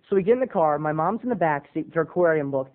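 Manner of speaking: ordinary talk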